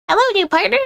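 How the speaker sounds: high, eager greeting; high character voice. Acted. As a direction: chirp it out eagerly. New student greets Miriam.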